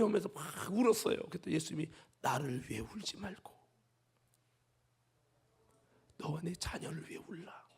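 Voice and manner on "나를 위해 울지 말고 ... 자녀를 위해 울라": hushed, much softer words of Jesus; Jesus hushing the weeping women disciples; solemn whisper. Acted.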